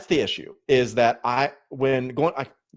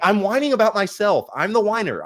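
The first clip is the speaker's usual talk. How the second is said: higher, lightly whining self-mockery; a touch of whining, pitched up; whiny voice; 9 semitones higher, 3 dB louder than usual. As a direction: whine a little at yourself. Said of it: "that's my whiny voice".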